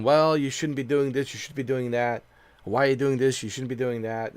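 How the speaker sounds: normal talking voice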